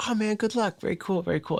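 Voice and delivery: high-pitched imitation of an easygoing director; Quentin Tarantino. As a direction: mimic a film-geek director wishing a fan well, high-voiced. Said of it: "like, quoted Quentin".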